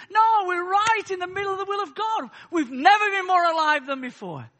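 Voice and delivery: loud, high, rousing pulpit cry; fervent preacher in full flow; impassioned preaching. Real, not acted. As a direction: preach it at full force, building to a declaration.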